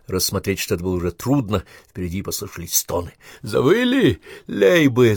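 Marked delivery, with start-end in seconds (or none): 3.4-5.2 high, loud, spiteful yell; raised voice full of spite; angry shout. A narrator's reading. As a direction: jeer angrily at the enemy. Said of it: "зло прокричал Косенко".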